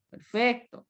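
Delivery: normal talking voice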